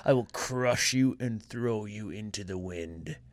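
cold rasping evil emperor; low, slow, menacing villain growl; Vegeta impression. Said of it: "doing his best Vegeta impression".

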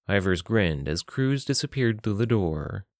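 ordinary delivery, nothing marked

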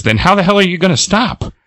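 high exasperated question. Retold or imitated. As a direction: demand it, half panicked. Character alarmed.

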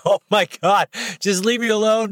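high-pitched, retold inner plea; exasperated. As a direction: mock-desperate wish to be left alone. Retold exasperation at being followed.

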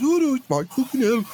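high-pitched mock panic; comic high-voiced alarm; imagined old-time gangster. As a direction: squeal it like a scared crook. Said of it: "I can imagine back then it was".

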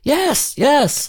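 two very high, excited replies; amazed yes. A narrator's reading. Boy and lion answer at the exact same time, amazed. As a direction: pitch both words way up in amazement. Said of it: "both of them said at the exact same time".

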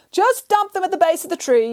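high yell down from a tree. Acted. Nanny Piggins to the foreman below, a high yell. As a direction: shout it down to the foreman. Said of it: "yelled Nanny Piggins".

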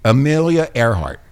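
normal talking voice

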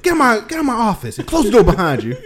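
high, loud scolding command; bossy shouting. Pretend. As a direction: order him out like a boss. Voicing bossy wife.

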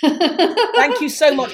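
laughing through the thanks, slow; thanking the host for the compliment, laughing. Real, not acted. Thanking host Alison after praise, laughing slowly.

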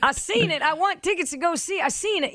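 high mock voice of a rock-station caller, one laugh; mock rocker caller. DJ mocking a classic rock caller in a high voice. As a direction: ham up a Harley rocker calling in.

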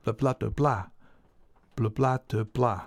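rhythmic vocalised flam pattern; drum speak. Shown as a demonstration. Drummer voicing a flam pattern for listeners, drum speak. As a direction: sound out drum strokes with nonsense syllables. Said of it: "Good bit of drum speak there".

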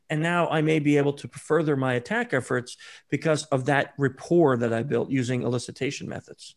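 ordinary talk, nothing marked

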